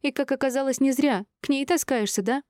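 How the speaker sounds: high, indignant jealous retort; wounded wife's accusation; accusing. A narrator's reading. Jealous wife accuses husband.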